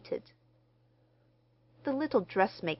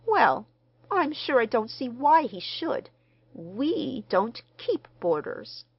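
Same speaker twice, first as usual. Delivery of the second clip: frosty refusal; 5 semitones higher, 5 dB louder than usual. Acted. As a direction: shut the idea down coldly. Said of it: "unmistakable frown".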